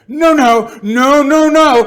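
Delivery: shouted noes, high and very loud; mounting protest. Acted. Interlocutor protests ever harder.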